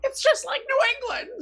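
high mocking enthusiasm; mock excited. Real, not acted. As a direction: tease with a high voice. Jokey comparison, high voice.

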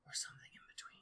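breathy hushed whisper. Real, not acted. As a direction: whisper it with a gasp first. Gasp then hushed aside.

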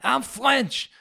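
high-pitched, hammed-up French accent; outrageous French accent. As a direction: taunt in an absurd French accent. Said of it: "this outrageous accent".

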